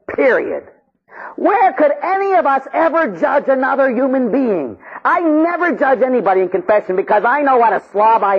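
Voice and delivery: high, fervent preacher delivery; impassioned preaching. Acted. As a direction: exhort the congregation with rising intensity. Preaches at full force.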